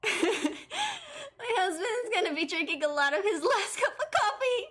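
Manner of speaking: high, laugh-choked words; giggling almost to tears; laughing while talking. Real, not acted. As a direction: squeeze the line out through laughter. Laughing hard at a joke.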